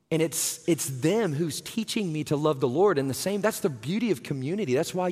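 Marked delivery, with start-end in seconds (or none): none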